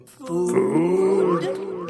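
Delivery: soft, high, drawn-out moan; eerie, hushed zombie drone. Acted. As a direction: moan it long and softly like a hungry zombie. Zombies' one-word thought voiced in narration.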